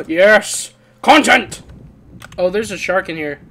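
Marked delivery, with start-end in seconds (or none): 0.1-1.6 loud, high triumphant shout; gasp, then shout it out in playful triumph; exuberant cheer. Pretend. Told to go get content.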